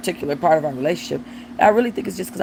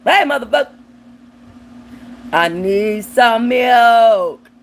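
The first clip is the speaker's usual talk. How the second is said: much higher, loud mock baby demanding milk, stretched slow at the end; mock newborn; 5 semitones higher, 6 dB louder, slower than usual. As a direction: play a bossy newborn yelling for milk. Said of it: "come out the womb and say".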